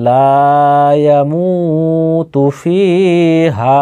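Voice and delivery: slow recitation, লা, মু, ফি and হা held long; drawn-out madd. Shown as a demonstration. Teacher quizzing learners on madd, slow drawn-out recitation. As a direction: stretch লা, the মু of ইয়ামুতু and both vowels of ফিহা. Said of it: "আবার উচ্চারণটি বলছি".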